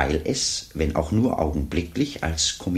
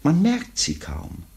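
normal talking voice

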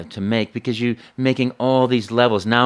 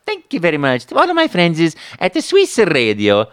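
high, loud Italian Mario cheer; 7 semitones higher, 5 dB louder than usual. Acted. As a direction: sign off in Mario's voice. Signing off as Mario.

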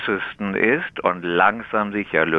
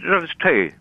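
Tee in higher East Frisian Platt; East Frisian Low German; 8 semitones higher than usual. Shown as a demonstration. Tea expert shows the radio host the local word, East Frisian Low German. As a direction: demonstrate East Frisian dialect. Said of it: "Wie sagt man dem Tee auf Ostfriesisch".

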